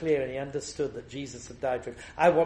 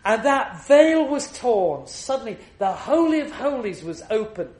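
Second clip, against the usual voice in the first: preaching at full force; 8 semitones higher, 9 dB louder than usual. Acted. Preacher to his congregation on the cross, thundering sermon. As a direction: proclaim it to the back of the church, hammering every refrain.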